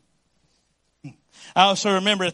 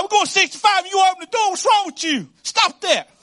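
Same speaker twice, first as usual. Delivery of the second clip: much higher, louder and fast, hammed-up panic; mock alarmed yell; 12 semitones higher, 5 dB louder than usual. Pastor retells his wife opening the car door, a hammed-up alarmed yell. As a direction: yell at her like the car is speeding.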